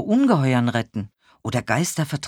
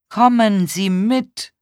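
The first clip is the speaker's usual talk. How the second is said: high, slow, projected instruction; over-enunciate for someone hard of hearing; slow, raised voice, each word distinct; loud and clear; 8 semitones higher, 7 dB louder, slower than usual. A narrator's reading. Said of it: "besonders laut und besonders deutlich auszusprechen".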